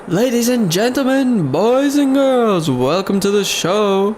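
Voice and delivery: showman's bold call, drawn out; ringmaster announcer. Acted. Ringmaster welcoming the crowd to the show, grand announcer voice. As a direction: belt it out like a circus ringmaster. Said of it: "announcing the circus show".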